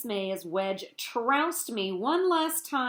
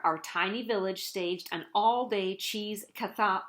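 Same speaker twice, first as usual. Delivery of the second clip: plain reading voice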